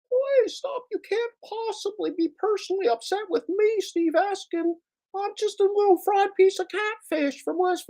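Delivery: very high squeaky mimicry of a whining man; squeaky mock whine of the rival; mocking falsetto impression. Imitating Steve in squeaky voice.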